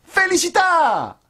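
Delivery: very high, slow puppet blessing; squeaky puppet bless you; Pinocchio's voice. A narrator's reading. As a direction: chirp it like a little wooden boy. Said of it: "disse Pinocchio".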